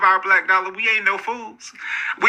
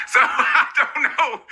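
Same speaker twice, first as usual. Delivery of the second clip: laughing through words; 4 semitones higher, slower than usual. Real, not acted. Laughing after joke about cleanliness.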